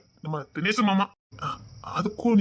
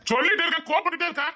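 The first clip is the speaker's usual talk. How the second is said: exasperated scolding; 8 semitones higher than usual. Acted. Scolding someone who stays angry.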